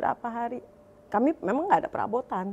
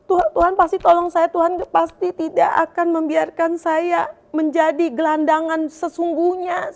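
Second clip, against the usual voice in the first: pleading prayer; 4 semitones higher, 8 dB louder than usual. Retold or imitated. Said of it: "Saya bilang sama Tuhan".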